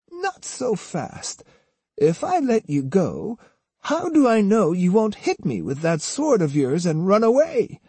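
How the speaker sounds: high-pitched, suspicious granny; wary old woman. A narrator's reading. Osugi doubts the captive boy's promise, warily. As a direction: hold him back in a high old lady's voice.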